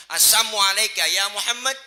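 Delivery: high, loud hostile greeting; loud taunt. Acted. Unbeliever taunting the Prophet with false greeting, loud taunt. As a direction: throw the greeting out as a challenge.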